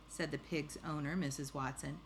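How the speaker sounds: ordinary delivery, nothing marked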